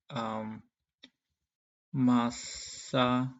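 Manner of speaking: ordinary delivery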